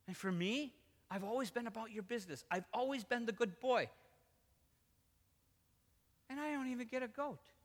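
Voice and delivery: wounded, indignant son; aggrieved older son. Acted. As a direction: protest like the overlooked good boy. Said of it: "he says".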